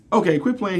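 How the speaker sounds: high-pitched, exasperated disbelief; disbelieving. Retold or imitated. Woman thinks she is being teased.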